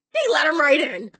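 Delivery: loud, high burst of indignation; indignant. Real, not acted. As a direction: hit it hard, outraged at their negligence. Outrage at the nurses' failing.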